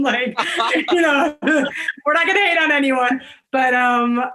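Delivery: real amused laugh while talking. Real, not acted. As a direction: laugh off the dig. Laughing off FedEx jab.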